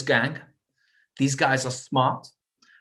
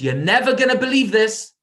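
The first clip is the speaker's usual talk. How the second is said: higher, louder call across the shop; calling out to brother; 7 semitones higher, 6 dB louder than usual. Retold or imitated. Brother calls out from the safe, loud and high. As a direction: shout the news back to him. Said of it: "he screams to his brother and he says".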